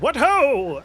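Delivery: high, loud, silly rallying cry; hammed-up heroic call to march; Sir Ham. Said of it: "for me to do a funny voice".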